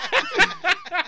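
squealing laugh; high laugh. Real, not acted. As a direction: shriek of laughter.

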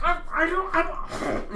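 high, whiny stammer trailing off; mocking wife. Husband imitating his passive wife, stammering. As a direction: play her as helpless and evasive. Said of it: "she's like".

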